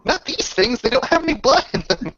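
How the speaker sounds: high, insistent protest; playful argument. Real, not acted. Arguing with player.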